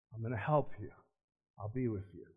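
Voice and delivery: hushed voice of Jesus promising help; gentle promise. Retold or imitated. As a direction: say it as a quiet reassurance.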